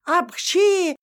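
громкий наигранный чих; чихнуть резко и громко. Acted. Чих свекрови. (громкий чих с порога)

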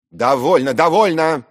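high, loud repeated command; imperious, insistent cry; shouting. Acted. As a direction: cut off the quarrel with a shout. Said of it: "кричал Зверков".

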